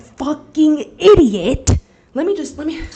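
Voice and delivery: sudden loud outburst on idiot; exasperated yell. Real, not acted. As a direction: blurt out idiot in frustration. Ranting about eaten bagels.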